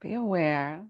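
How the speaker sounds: unhurried yoga nidra guidance with long pauses; measured, spacious relaxation-teacher delivery; guided meditation. Acted. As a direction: ease them into rest, phrase by phrase.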